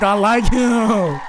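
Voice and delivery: high, drawn-out teasing imitation; mock smitten woman. Host teasing woman about her crush, mock smitten drawl. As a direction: stretch it out slowly, high, mimicking her crush. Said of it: "She like".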